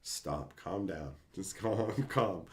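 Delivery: soft, low, hushed placating; imitated calming plea. Carly tries to settle him as he yells at the TV, soft calming. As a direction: quietly talk someone down. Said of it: "She would just be like".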